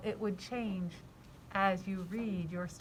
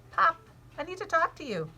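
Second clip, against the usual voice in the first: put-on mild girl voice; 6 semitones higher, 4 dB louder than usual. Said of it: "my voice is a little different".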